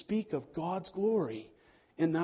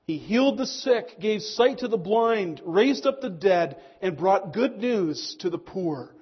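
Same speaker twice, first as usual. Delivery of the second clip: ringing, louder sermon voice, slowing on the last line; preaching at full force; 6 dB louder than usual. Acted. Preacher lists the deeds of Jesus to the congregation, preaching at full force. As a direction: proclaim each deed to the whole hall.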